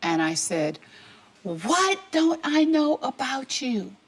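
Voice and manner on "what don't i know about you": much higher, slow mock yell; a retold demand, pitched up and drawn out; imitating her own angry shout, higher and slower. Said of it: "yelling at him at the top of my lungs".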